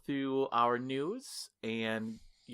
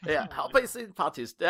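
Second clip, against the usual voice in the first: weak attempt at an Irish accent; a hammed-up Irish accent; bad Irish accent; 6 semitones higher, 5 dB louder than usual. Shown as a demonstration. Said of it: "My Irish accent is not good".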